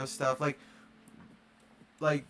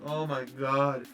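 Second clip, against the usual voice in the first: slow, drawn-out dismay; retold dismay; much slower than usual. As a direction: let it drag out in understated disbelief. Reaction to seeing bare primer.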